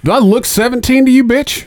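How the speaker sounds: high, laughing mock sass; laughing indignant comeback; mock retort. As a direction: fire it back with sass.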